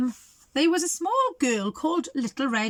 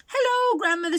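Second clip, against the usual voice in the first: put-on high, louder girl calling; 10 semitones higher, 4 dB louder than usual. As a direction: call through the door brightly. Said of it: "she called".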